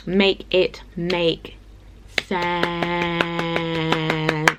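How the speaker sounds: very slow, each word stretched; slow exasperated plea. Real, not acted. Pleading with the stranger to make sense, very slow. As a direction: stretch it out in weary disbelief. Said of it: "make yourself make sense".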